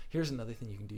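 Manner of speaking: usual speaking voice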